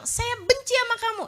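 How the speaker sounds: hammed-up angry spouse; husband shouting at his wife, imitated; mock angry husband. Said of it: "kalau suaminya bilang".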